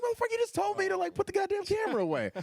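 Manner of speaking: high mock outrage at a friend; mock indignant squeal. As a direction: squeak it out in disbelief.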